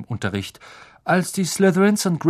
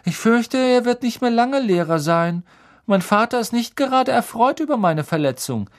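high, smug, louder voice; gloating schoolboy; 11 semitones higher, 4 dB louder than usual. A narrator's reading. Malfoy predicts Hagrid's sacking, smug and high. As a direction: gloat with false regret, pitched high.

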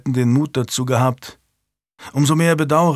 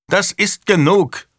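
loud, slow, higher thunder of a king; 7 semitones higher, 3 dB louder, slower than usual. Acted. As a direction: let the king's anger boom out. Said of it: "donnerte Edward". (donnernd, laut und langsam)

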